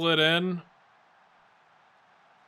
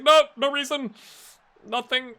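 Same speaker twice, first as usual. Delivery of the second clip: higher, hasty fake innocence; mock innocent denial; 11 semitones higher, 8 dB louder than usual. Streamer answering his work team, mock innocent. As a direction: brush it off like you're hiding something. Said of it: "It's like".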